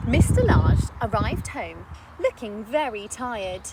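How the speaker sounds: ordinary delivery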